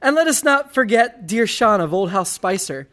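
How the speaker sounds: ordinary talk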